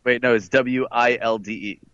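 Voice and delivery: normal talking voice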